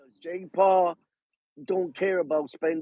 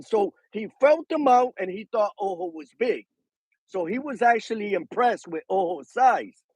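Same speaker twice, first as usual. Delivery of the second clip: normal talking voice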